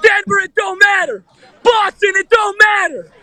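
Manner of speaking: high, chanting fan holler; real hyped-up arena yelling; boastful victory shout. Real, not acted. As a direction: shout each boast over the crowd. Fan boasting amid arena crowd.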